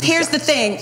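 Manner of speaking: ordinary talk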